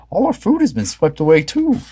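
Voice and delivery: mournful Shaggy. A narrator's reading. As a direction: lament the lost food. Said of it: "said Shaggy".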